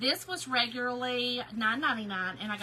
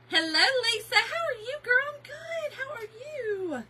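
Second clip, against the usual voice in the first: much higher, sing-song hello; falsetto greeting; 13 semitones higher, 7 dB louder than usual. Acted. Greeting a viewer, high chirpy falsetto. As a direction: greet like a chirpy little bird.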